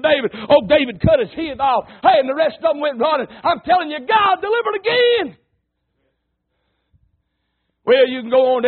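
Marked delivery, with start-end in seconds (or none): 3.3-5.4 preaching climax. Real, not acted. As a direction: proclaim it, pitch soaring on God delivered.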